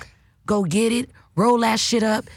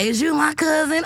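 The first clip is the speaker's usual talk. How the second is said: Martin Lawrence impression; 6 semitones higher than usual. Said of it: "if you sound like Martin Lawrence".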